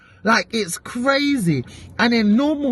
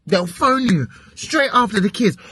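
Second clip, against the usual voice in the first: normal talking voice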